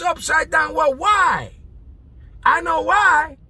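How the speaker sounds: loud, high, fired-up preaching; preaching at full force. Acted. Preacher firing up the congregation, loud and high. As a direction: preach it out with rising fire.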